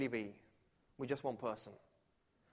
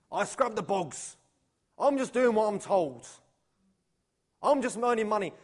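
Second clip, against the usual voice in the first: grudging worker; 3 semitones higher, 6 dB louder than usual. Acted. Church workers describe their jobs, grudgingly. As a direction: stress each word like a put-upon worker.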